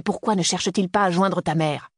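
plain reading voice

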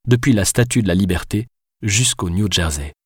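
ordinary delivery

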